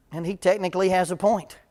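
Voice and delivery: usual speaking voice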